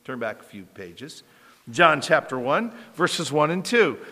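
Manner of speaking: ordinary talk, nothing marked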